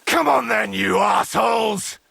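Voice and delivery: high, loud yell to attackers; battle challenge shout. Acted. Daring attackers to come on, full battle shout. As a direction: shout them on into the fight.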